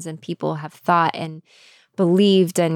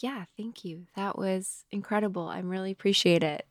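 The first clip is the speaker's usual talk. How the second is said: soft gratitude; 9 dB quieter than usual. Retold or imitated. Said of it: "you can also just be like".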